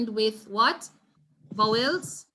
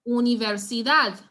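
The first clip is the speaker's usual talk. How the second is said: "Universidad" louder, landing hard on final DAD; stress on DAD; 5 dB louder than usual. Shown as a demonstration. Teacher repeating for the class, "Universidad" stressed on DAD. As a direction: model u-ni-ver-si-DAD, last syllable punched. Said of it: "emphasis will be placed on the dad".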